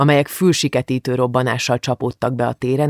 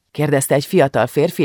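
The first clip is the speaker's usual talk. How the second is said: ordinary delivery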